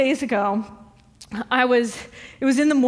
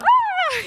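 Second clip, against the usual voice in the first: very high yelp while flinging; disgusted yelp; 3 dB louder than usual. Retold or imitated. Flinging the cockroach outside, high shrieking yelp. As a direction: shriek as you throw it all away.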